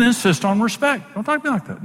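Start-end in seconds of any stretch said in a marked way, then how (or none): 1.1-1.8 "talk" pushed much higher, falling off at the end; a high, firm refusal, lightly acted; voice going up. Imitating the wife, voice climbing as she stands firm. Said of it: "my voice is going up".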